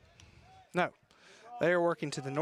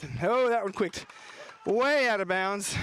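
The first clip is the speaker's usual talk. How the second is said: ordinary talk, nothing marked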